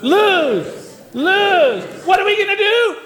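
high, loud, sinking cheer chant; hopeless cheer. Pretend. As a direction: cheer for losing, high and falling. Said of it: "pessimistic cheerleaders".

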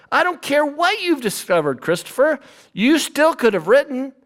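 guilt-tripping mother. Acted. A mother scolding her explorer son for not writing, high reproachful mom voice. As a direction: lay the guilt on thick as his mother. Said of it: "how about Columbus's mother?".

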